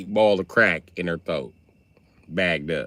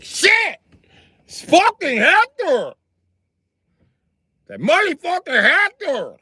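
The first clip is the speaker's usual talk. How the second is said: much higher, loud alarmed shriek, slow; panicked suspect; 5 dB louder, slower than usual. Retold or imitated. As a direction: shriek in alarm at seeing Hector. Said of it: "be like".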